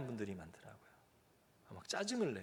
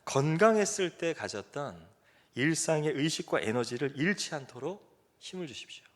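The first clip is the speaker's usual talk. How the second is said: earnest prayer; 2 semitones higher, 4 dB louder than usual. Retold or imitated. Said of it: "정말 간절히 기도했습니다".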